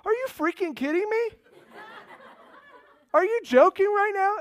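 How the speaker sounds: high, loud incredulous cry; overwhelmed disbelief. Retold or imitated. Preacher reliving hearing the woman's word, crying out in disbelief. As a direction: burst out as if you cannot believe it. Retells his stunned reaction, at full pitch.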